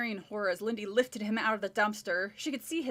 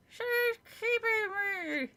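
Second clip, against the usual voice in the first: squeaky dummy voice; 11 semitones higher, much slower than usual. Acted. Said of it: "she made the dummy say".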